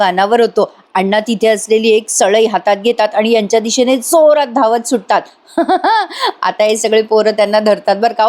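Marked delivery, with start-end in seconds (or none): 5.6-6.4 burst of laughter. Real, not acted. Narrator laughing at scene.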